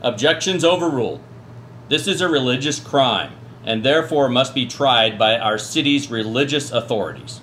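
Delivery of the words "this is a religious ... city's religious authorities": normal talking voice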